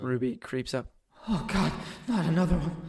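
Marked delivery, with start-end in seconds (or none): 1.3-2.8 higher, softer, hurried groan of dread; dismayed captive. Acted. Prisoner seeing Ruby creep up, weary dismay. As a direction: despair quietly at a new threat. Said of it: "You hear him say".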